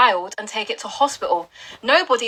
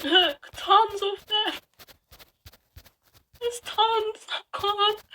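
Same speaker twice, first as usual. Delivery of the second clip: overwhelmed; 9 semitones higher, 4 dB quieter, much slower than usual. Real, not acted. Overwhelmed, voice rising.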